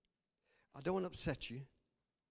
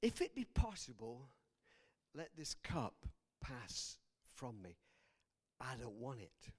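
quiet pleading prayer; 6 semitones lower, 6 dB quieter than usual. Acted. Jesus begs his Father in the garden, hushed prayer. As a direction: pray it softly, with heavy pauses.

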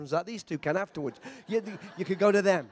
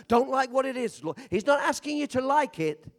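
usual speaking voice

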